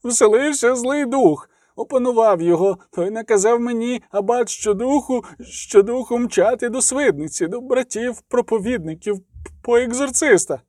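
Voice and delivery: high, tearful, panicky account; a frightened young monk pleading his case; distraught outcry. A narrator's reading. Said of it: "викрикнув ченчик".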